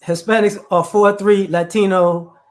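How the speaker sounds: ordinary talk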